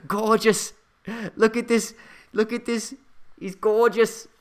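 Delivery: high, hammed-up Australian wildlife host; Crocodile Hunter. Livestream host admiring a falcon for viewers, terrible Australian accent. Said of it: "That's the crocodile hunter".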